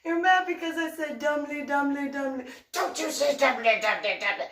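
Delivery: high giggling teasing about "domly"; laughing tease. Real, not acted. Teasing Coyote about "domly" while giggling. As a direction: tease him, barely holding the laughter.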